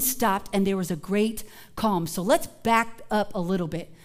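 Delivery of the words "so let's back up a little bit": ordinary talk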